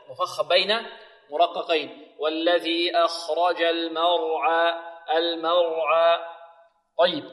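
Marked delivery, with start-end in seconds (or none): 2.2-6.2 slow, measured tajweed recitation with long held vowels; Quran recitation. Shown as a demonstration. Teacher models the verse for pupils, slow tajweed recitation. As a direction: draw out each syllable in an even chant.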